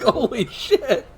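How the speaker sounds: slow, laughing holy shit; laughing in disbelief at the story; laughing awe. Real, not acted. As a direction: say it through laughter, amazed. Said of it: "it's like".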